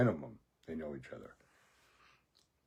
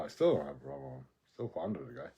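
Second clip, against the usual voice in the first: ordinary talk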